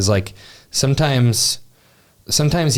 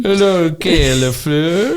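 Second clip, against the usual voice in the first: mock announcer; 8 semitones higher, slower than usual. Teasing announcer greeting.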